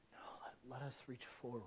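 very soft, low, almost whispered; hushed, low plea; hushed urging. Real, not acted. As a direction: drop to a near whisper.

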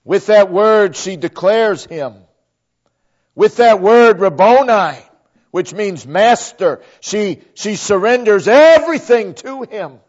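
ordinary talk

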